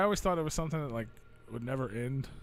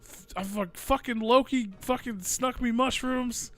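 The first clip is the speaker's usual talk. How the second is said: high-pitched, hammed-up panic; loud, worked-up mock distress, swearing all through; freaked-out prank caller; 9 semitones higher, 6 dB louder, slower than usual. Telling a friend he was drugged in the woods, freaking out. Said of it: "Freaking out, man".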